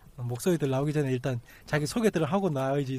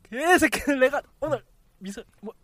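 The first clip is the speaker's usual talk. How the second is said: angry; 8 semitones higher than usual. Real, not acted. Cursing the client again.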